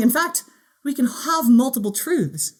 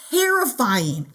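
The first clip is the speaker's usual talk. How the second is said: terrifying stretched out slowly after a pause; slow dramatic terrifying; drawn-out terrifying; much slower than usual. Real, not acted. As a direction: linger on terrifying, slow and heavy. Dramatic pause before key word.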